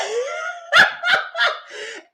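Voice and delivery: very high loud laughter; shrieking laugh. Real, not acted. Speaker laughing at Tadell's firecracker, shrieking laugh. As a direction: let out a shrieking cackle.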